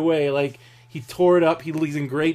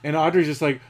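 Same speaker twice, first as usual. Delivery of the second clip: ordinary talk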